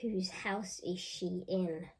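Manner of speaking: ordinary delivery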